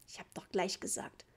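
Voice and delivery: low-key, muted crossness; cross. A narrator's reading. Father annoyed at paying for nothing, cross. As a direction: grumble it under the breath. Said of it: "sagte Papa böse".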